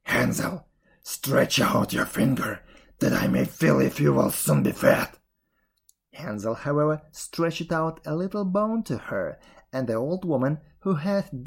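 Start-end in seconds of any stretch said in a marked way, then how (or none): 0.0-5.1 forceful put-on witch voice; crying witch. A narrator's reading. Witch calling to caged Hansel in the stable, commanding. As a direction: call out a command as the wicked witch. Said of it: "crept to the little stable and cried".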